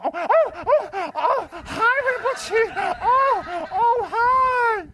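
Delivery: very high, loud, shrieking oh hi; flustered devotee. Retold or imitated. Devotee opens the door to Rinpoche, very high and flustered. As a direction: squeal like someone caught out.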